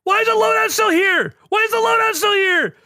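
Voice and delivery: high, loud yelling; panicked shout at the stuck loadout; retold scream. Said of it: "you hear me just screaming".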